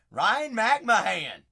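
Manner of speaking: loud, slow, drawn-out name call; hyped slow name call; card call. Real, not acted. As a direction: announce the pull. Announcing a pulled card.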